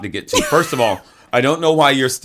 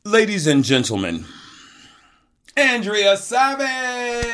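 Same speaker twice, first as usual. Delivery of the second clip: slow, drawn-out, higher game-show introduction; grand announcer intro; 10 semitones higher than usual. Acted. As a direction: stretch the name out like a host hyping a star. Announcing the guest's entrance.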